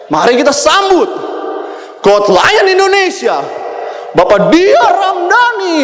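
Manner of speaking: shouted announcer. Retold or imitated. Speaker replays his bathroom affirmation as a high, slow, shouting stage announcer. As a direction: belt it out like a gala host calling your name. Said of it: "sambil teriak".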